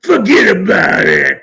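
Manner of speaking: loud, hammed-up New York mobster accent; [name]'s mobster accent. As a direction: shout it like a New York gangster, imitating. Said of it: "he can talk like a mobster".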